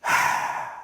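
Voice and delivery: long slow exhale sigh; showing the calming breath; demo sigh. Shown as a demonstration. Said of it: "long exhale".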